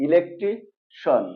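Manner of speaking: "ইলেকট্রিশন" slowly, ending in one syllable shon; correct SHON ending. Shown as a demonstration. Teacher gives the correct pronunciation, "ইলেকট্রিশন" ending in shon. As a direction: draw out "ইলেকট্রিশন" as e-lek-tri, then shon. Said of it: "recap".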